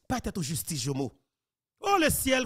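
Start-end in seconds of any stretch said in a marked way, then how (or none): 1.8-2.5 dismayed cry. Real, not acted. Host exclaims at news.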